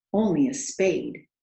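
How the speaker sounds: plain reading voice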